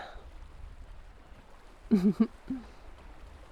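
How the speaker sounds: breathy, amused little laugh. Acted. Villain amused at princess.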